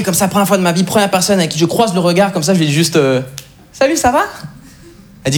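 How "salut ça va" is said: high, singsong hello; cheery greeting. Retold or imitated. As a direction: lightly mimic the Montreal chirp. Imitating the greeting in Paris.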